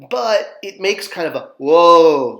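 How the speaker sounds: "whoa" drawn out, slow and loud from the back of the throat; demo whoa sound. Shown as a demonstration. Teacher modelling the Egyptian sound, slow throaty demo. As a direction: open the throat and stretch "whoa". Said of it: "like a W with an A in the back of your throat".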